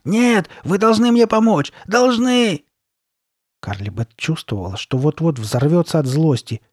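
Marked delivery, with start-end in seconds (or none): 0.0-2.6 high, loud, desperate shouting; screaming. A narrator's reading. Girl demanding the shopkeeper's help, screaming. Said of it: "закричала она".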